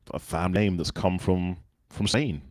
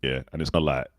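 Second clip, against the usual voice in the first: casual, chilled Southeast London accent. Shown as a demonstration. Guest showing his other way of speaking, Southeast London accent. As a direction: slacken into Southeast London street talk. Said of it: "I can speak like Southeast London".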